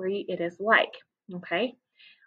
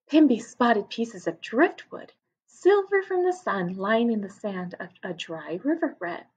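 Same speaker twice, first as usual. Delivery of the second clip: put-on lively read-aloud for children; story narration; 4 semitones higher, 6 dB louder than usual. A narrator's reading. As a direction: tell it to a class of young kids. Reads story aloud to class.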